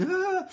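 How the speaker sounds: a sharp high loud wail; mock child's wail, high and loud. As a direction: cry out like a child in turmoil. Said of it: "and he's".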